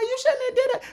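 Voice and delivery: high, mimicked woman's voice; mock scolding girlfriend. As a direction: play the hurt girlfriend, hammed up. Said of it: "she's like".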